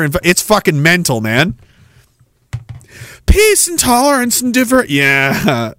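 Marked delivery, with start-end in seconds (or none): 3.3-4.9 high, mocking sing-song. Parroting communists' slogans after calling them vicious, mocking.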